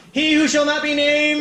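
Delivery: high-pitched mock call-out; imitated yell. Restaurant worker calling out a food order, imitated yell. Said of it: "yelled out loud".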